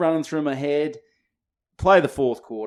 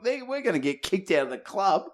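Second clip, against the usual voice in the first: panicked mates; 8 semitones higher than usual. Retold or imitated. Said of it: "we're like".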